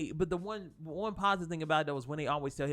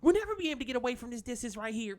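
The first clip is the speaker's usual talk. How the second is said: high, rapid mock whine; mimicked whining, pitched up and rushed; Luke Skywalker whining; 7 semitones higher, 3 dB louder, much faster than usual. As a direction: rattle it off like a whiny complainer. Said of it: "he started whining".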